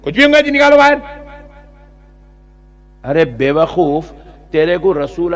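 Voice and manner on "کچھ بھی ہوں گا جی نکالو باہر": high, loud mocking yell; mock furious cleric. Preacher imitates clerics expelling a Sayyid, mock furious yell. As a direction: bark it like a cleric throwing someone out. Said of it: "ارے سید ہے".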